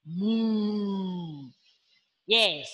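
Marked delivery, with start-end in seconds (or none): 0.0-1.5 soft low moo. Shown as a demonstration. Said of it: "A cow moo".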